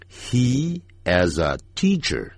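He is a teacher slow and linked, teacher falling; linked sentence. Shown as a demonstration. Teacher modelling He is a teacher slowly. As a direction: model the whole line slowly. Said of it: "就这么念".

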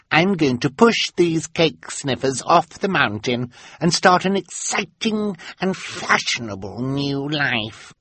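put-on high, gleefully nasty child voice; bratty little girl. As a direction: crow the plan, stretching the end. Carmelita declares her nasty plan.

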